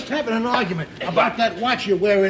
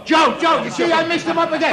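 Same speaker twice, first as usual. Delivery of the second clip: excited correction; 8 semitones higher, 3 dB louder than usual. Acted. Correcting a mixed-up name.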